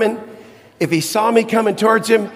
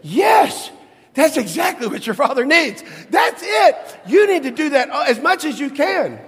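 mother bursting with emotional approval; mom's emotional outburst; 8 semitones higher than usual. Retold or imitated. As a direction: cry it out, overwhelmed with yes. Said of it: "my mom broke, and she said".

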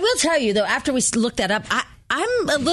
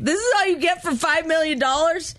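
higher-pitched imitation of crying complaint; mock tearful dismay; 7 semitones higher than usual. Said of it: "she's crying because she's like".

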